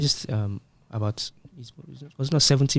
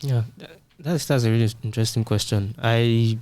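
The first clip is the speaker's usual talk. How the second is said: usual speaking voice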